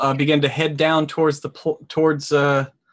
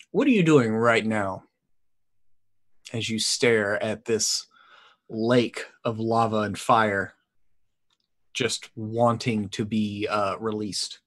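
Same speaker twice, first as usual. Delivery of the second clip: low, soft, slow narration; trailing softly into a question; game master's scene setting; 5 semitones lower, 4 dB quieter, slower than usual. A narrator's reading. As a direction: draw the player into the scene, hushed.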